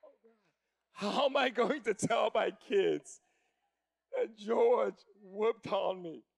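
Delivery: slow, dragged-out dread; mock despair. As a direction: stretch it out like a defeated dad. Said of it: "I was like".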